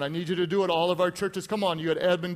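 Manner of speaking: ordinary talk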